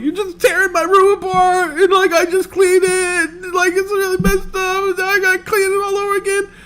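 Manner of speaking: high whining complaint; whiny kid. Retold or imitated. Kid whining to mom about messy room, high and whiny. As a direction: whine like a teary child. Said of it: "I was like".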